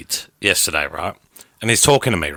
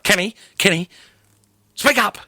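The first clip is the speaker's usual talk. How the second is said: lightly voiced mum, higher, calling Kenny to speak up; a mum nagging her son to speak up, a little higher; 8 semitones higher than usual. Mum telling Kenny to speak up, lightly voiced. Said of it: "my mum is hilarious".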